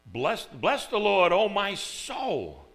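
slow, loud and reverent; formal King James delivery; solemn verse reading. Retold or imitated. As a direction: stretch out "Bless the Lord" with awe. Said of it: "it's kinda like saying".

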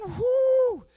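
high breathless whoo after running; exhausted whoo. Retold or imitated. Preacher retelling his sprint, high breathless whoo.

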